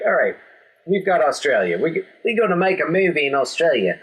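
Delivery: playful mock Australian accent. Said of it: "It's my Australian accent".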